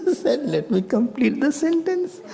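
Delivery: very high, squeezed out through laughter; laughing. Real, not acted. Teacher retelling the joke's punchline, laughing. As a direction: struggle to finish the line while laughing.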